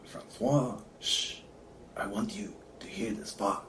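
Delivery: whispered, low French accent attempt. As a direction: murmur it softly with a poor French accent.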